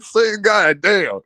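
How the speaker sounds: ordinary talk, nothing marked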